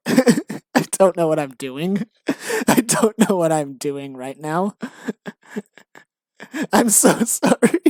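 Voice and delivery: real giggles breaking up the words; helpless laughter. Real, not acted. Writer apologizing to Aaron, helplessly laughing. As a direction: apologize while cracking up. Cracking up at own script.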